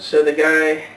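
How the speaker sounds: ordinary talk, nothing marked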